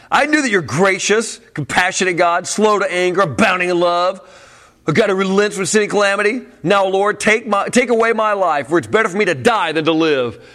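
high, loud, ticked-off reading; mock angry prayer. Shown as a demonstration. Preacher acting out Jonah's angry prayer to God. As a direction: read it like he's furious at God. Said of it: "I can't read it mean".

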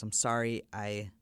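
halting retold confession, long pauses; subdued, hesitant stammer; slight stutter. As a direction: falter and restart, searching for the words. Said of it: "starts to stutter a little bit".

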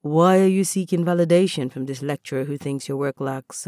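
usual speaking voice

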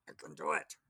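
growled monster voice; grotesque monster voice. Shown as a demonstration. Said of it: "that has to be the monster voice".

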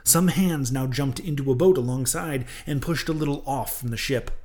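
ordinary delivery, nothing marked

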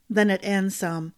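plain reading voice

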